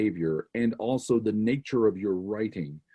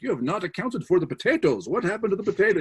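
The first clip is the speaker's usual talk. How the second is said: mock nagging Dutch; 8 semitones higher, faster than usual. Dutch allies nagging John over accounts.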